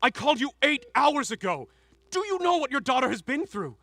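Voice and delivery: angry confrontation. Acted. Father berating wife over sick daughter, angry confrontation. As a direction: build to a high, loud accusation.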